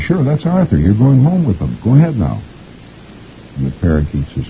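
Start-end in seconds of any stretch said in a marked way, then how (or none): 0.0-2.4 put-on kid Ira. A narrator's reading. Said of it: "And Ira says".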